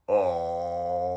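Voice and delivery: low, drawn-out bashful oh; a softly put-on blushing coo. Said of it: "here he is all blushing, going".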